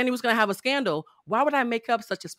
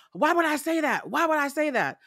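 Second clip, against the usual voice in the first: higher, whiny angry frog voice; mock angry Kermit; 6 semitones higher than usual. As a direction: imitate her like an upset Kermit. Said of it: "typing like angry Kermit".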